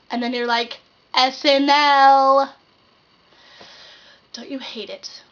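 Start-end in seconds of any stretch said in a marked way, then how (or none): 1.1-2.5 loud, slow, spelled-out letters; mock exasperated yell; exasperated shout. Exasperated explaining the quote. 4.3-5.2 softly whispered opener; hushed whispered aside. Acted.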